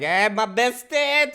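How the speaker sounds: drawn-out, loud, high hey-bestie greeting; stretched, beaming mock greeting; greeting a bro. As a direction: call it out slowly and loudly to your bro. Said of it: "I'll be like".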